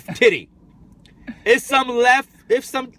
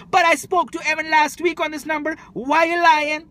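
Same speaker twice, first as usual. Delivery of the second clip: put-on Trini accent; 9 semitones higher than usual. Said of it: "Do your Trini accent again?".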